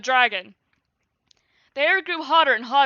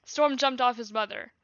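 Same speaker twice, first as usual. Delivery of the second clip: ordinary delivery, nothing marked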